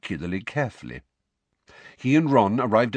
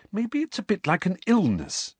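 Hermione voice; 10 semitones higher than usual. Acted. Hermione voiced in read story.

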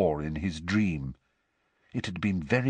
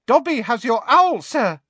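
very high squeaky elf voice; squeaky elf speaking from the dark; 14 semitones higher, 5 dB louder than usual. A narrator's reading. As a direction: pipe it up like a tiny house elf. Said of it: "said a squeaky voice".

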